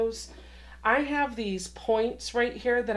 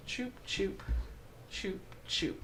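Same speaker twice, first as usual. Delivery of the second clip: soft little "choop" sound effects; tiny hushed mouth noises, evenly spaced; imitated snipping-like noise; 11 dB quieter than usual. Quilter voicing her work as she goes, soft imitated noises.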